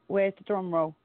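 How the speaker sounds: ordinary talk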